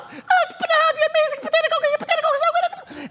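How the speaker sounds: high, rapid chatter of the wife; wife talking fast. Retold or imitated. Wife chatters about her potato dish, high and fast. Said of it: "And she would talk so fast".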